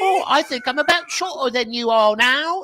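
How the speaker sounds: high-pitched British hobbit; mock hobbit. Hobbit remarking on height, high mocking voice. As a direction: say it high in a silly put-on accent.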